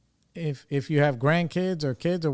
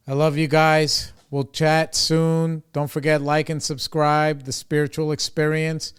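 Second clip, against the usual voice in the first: sign-off; 3 semitones higher, 6 dB louder than usual. Real, not acted. Vlog sign-off.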